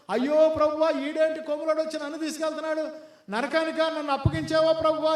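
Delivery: high, loud pleading lament; wailing to the Lord. Retold or imitated. A dead preacher wailing to the Lord, high and loud. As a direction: cry out to God in mock despair. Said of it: "గగ్గోలు పెట్టి ఏడుస్తున్నాడంట".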